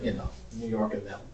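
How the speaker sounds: ordinary talk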